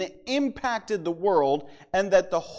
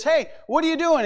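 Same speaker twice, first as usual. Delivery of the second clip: indignant higher-pitched scolding; mock-angry outburst in a retelling; mad prophet; 8 semitones higher than usual. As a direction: act out a mildly angry demand, voice jumping up. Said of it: "the prophet gets mad".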